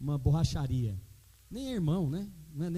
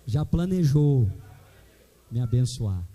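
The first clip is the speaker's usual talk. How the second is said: slow, louder declaration for the church to repeat; louder, slow preacher's line to echo; call and response; 3 semitones lower, 7 dB louder, slower than usual. Shown as a demonstration. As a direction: proclaim it so they say it back. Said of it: "Fala comigo".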